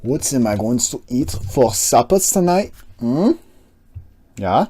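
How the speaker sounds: a playful put-on questioning voice. Streamer musing to himself about supper, in a put-on voice.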